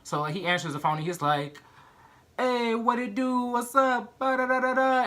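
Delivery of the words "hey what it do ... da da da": much higher, slow, laid-back street-guy voice. Retold or imitated. Mom's fiance answering the phone, a much higher, slow, laid-back voice.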